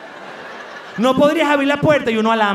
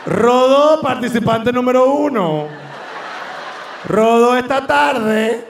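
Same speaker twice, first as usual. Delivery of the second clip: mock announcer; slower than usual. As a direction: boom it out like a contest host. Mock game show announcer.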